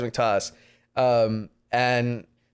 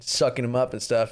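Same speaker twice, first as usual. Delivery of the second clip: normal talking voice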